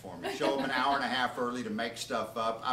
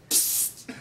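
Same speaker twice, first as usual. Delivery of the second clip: voiceless hiss, psst; a sharp secret psst from hiding. Retold or imitated. Hidden under a buffet, psst at a guest. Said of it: "so I do it again".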